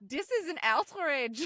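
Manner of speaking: loud, slow, high indignant protest; mock outrage. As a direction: pretend to be an outraged complainer. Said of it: "if the German audience, like, wrote too many letters".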